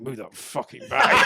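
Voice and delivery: slow, loud, annoyed mutter; muttered snap. Retold or imitated. Tired commuter snapping at a bag hog, muttered. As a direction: grumble it at the stranger, half laughing. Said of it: "I caught myself going".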